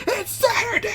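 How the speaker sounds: very high squeal breaking into laughter; excited hero impression. Imitating a hero remembering the grocery sale, excited squeal. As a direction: squeal it out, far above your normal pitch. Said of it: "he's just like".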